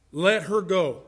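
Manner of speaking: firm, falling "Let her go"; measured statement, no question in it; judge's ruling. Acted. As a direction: hand down the verdict with finality. The judge's ruling after onlookers' disbelief.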